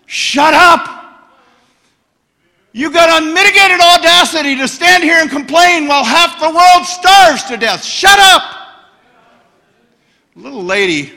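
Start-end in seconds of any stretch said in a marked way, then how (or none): 0.0-8.4 furious, high shouted rebuke; angry shout. Retold or imitated. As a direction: yell at the complaining shoppers, losing it. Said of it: "I just lost it. I just said".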